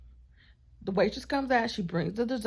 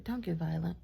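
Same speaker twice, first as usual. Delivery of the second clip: quieter, low and calm; 4 semitones lower, 6 dB quieter than usual. Retold or imitated. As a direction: settle him down with a soft, low warning. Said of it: "in such a calm, like, low way".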